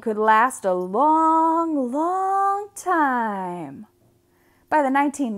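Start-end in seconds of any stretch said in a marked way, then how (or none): none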